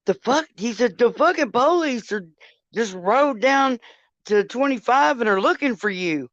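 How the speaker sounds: worked-up husband on the phone; retold alarm. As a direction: relay his incredulous outburst, pitch swinging up. Acting husband's alarmed phone call.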